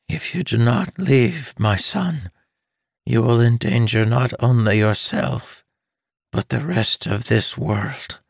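lightly voiced pleading mother; weak urgent mother. Dying mother urging her son to leave, weak. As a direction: urge him though strength fades.

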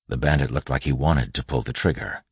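ordinary delivery

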